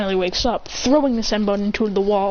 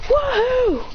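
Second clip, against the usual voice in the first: high, long whoop; gleeful whoop. Acted. They slide on the wood, gleeful whoop. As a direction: pretend ride whoop, pitched right up. They cheer while sliding.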